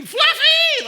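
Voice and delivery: very high, loud, drawn-out cry; frantic calling out. Retold or imitated. Neighbor searching for her cat outside the fire, frantic yell. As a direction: holler for a lost pet in a high-pitched voice.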